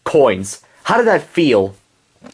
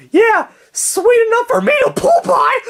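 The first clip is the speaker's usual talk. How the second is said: much higher sarcastic female voice. Acted. Henrietta mocking Leroy.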